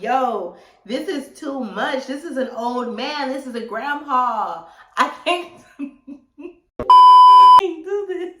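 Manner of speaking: loud, high protest breaking into laughter; exasperated protest. Retold or imitated. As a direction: object at the top of your voice, half laughing. Protesting, cracking up at the memory.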